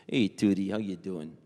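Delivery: low-pitched New York come-on; New York accent, dropped low. Acted. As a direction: chat someone up as a smooth New Yorker. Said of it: "like a New York accent".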